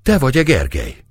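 high, lifted hailing question; calling out. A narrator's reading. Hungarian by a tent to a passing rider, calling out. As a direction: hail someone across the camp. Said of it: "magyar hang kiáltott rája".

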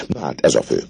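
ordinary delivery, nothing marked

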